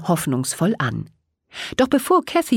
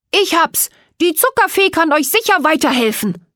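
higher, louder eureka cry; excited call; 11 semitones higher, 5 dB louder than usual. A narrator's reading. The gingerbread man calls out his idea to everyone. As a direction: burst out with the idea. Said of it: "plötzlich rief Knöpfle".

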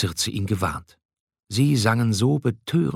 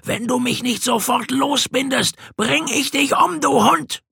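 furious shout. A narrator's reading. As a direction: spit the threat like a wild beast. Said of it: "schrie Odysseus".

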